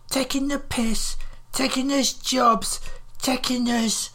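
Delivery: put-on high-pitched ranting northern old woman; angry volley. A narrator's reading. Livid old woman berates a southerner for mocking them, angry volley. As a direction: fire off accusations in a high, indignant voice. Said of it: "she fired off another volley".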